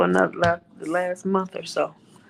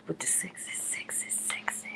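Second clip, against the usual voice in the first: whisper; 5 semitones lower, 18 dB quieter than usual. Acted. Playful whispered tease.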